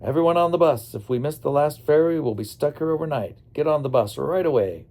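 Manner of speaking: narrated tour leader rallying everyone loudly; urgent announcement. A narrator's reading. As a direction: call out to hurry the group aboard. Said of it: "but Manorama announced".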